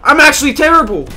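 loud, high wail of frustration; exasperated shout, rising; self-mocking outburst. Real, not acted. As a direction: build to a high yell at yourself. Outburst at own bad play.

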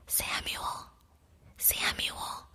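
soft breathy call from afar; a distant whispered call; gentle whisper. A narrator's reading. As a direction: call his name gently, barely voiced. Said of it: "the Lord said in a gentle voice".

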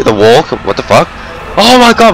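normal talking voice

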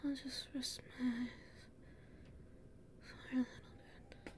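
weak, faint, fading whisper; fading exhaustion. Acted. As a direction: drift toward sleep in the cold. Freezing, exhausted, drifting off.

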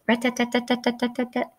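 rapid tapping like a little hammer; woodpecker rat-tat. Acted. Woodpecker in the park tapping, quick rattle like a hammer. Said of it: "sounds like a little hammer".

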